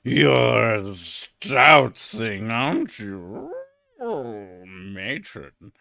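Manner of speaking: slow, leering lecher voice that fades to a murmur; lascivious prick. Retold or imitated. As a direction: leer at her like a dirty old man. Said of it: "some really fat, lascivious prick".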